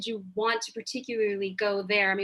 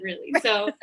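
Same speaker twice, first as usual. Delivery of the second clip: normal talking voice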